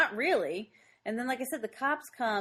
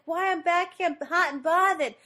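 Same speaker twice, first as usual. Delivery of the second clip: high, loud nagging nanny impression; Fran Drescher; 8 semitones higher, 5 dB louder than usual. Host imitating the actress complaining from the back, nanny voice. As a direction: complain in a raised mock nanny voice. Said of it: "she keeps yelling it".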